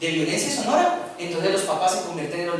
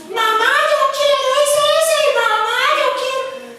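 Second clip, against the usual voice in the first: voz aguda de niño que pide; niño caprichoso; 4 dB louder than usual. Acted. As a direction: imita a un niño pequeño que exige. Niño pidiendo a la mamá. (voz aguda de niño pidiendo a su mamá)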